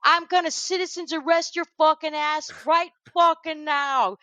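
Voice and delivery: imitated indignant rant, pitched high; high, insistent mock tirade; Michael Rapaport. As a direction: act out a man losing it at his harasser. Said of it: "just losing his shit".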